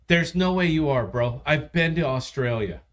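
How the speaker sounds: ordinary talk, nothing marked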